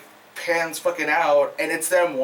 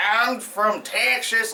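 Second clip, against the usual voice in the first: slow, higher mock Texan twang; hammed-up Texas accent; 4 semitones higher, much slower than usual. Reviewer mocking the film actor, exaggerated Texas accent. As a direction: stretch it into a cartoon Texas drawl. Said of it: "like, really, really, like, that sound".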